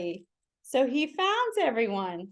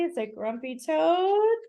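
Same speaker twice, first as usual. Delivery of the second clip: usual speaking voice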